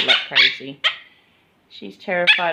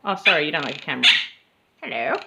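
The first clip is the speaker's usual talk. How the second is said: normal talking voice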